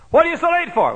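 loud, high scolding shout; scolding mother. Retold or imitated. Mother scolding her late son, loud angry shout. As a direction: demand an answer like an angry mother.

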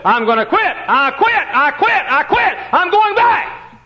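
high, loud cry of giving up; shouted quitting. Retold or imitated. Preacher voicing John Mark, shouting that he quits. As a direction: yell it like a scared boy throwing in the towel.

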